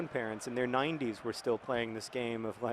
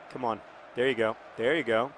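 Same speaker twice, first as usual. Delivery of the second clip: normal talking voice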